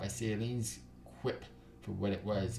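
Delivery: ordinary delivery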